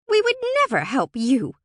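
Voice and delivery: high, loud, scornful refusal; indignant refusal. A narrator's reading. Brownie wrinkles its nose at Alora, indignantly refusing. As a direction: refuse with open hostility.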